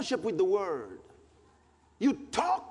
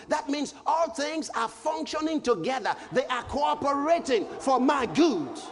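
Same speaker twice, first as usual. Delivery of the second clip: preaching at full force; 6 semitones higher than usual. Acted. Preacher proclaiming scripture to the congregation, full force. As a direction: shout it out to the church.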